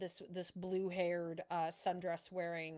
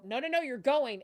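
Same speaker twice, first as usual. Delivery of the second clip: louder, higher forceful order; guard's command; 6 semitones higher, 8 dB louder than usual. Acted. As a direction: stop them dragging away. Guards seize the partner.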